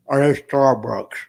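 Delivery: slow, drawn-out wife voice; mimicking the wife. Husband mimics wife asking about Starbucks, slow and drawn out. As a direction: drag the question out like his wife. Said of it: "she goes".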